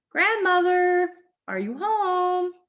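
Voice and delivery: high, drawn-out call into the house. A narrator's reading. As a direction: call out for someone, stretching the words. Child calls into grandmother's house.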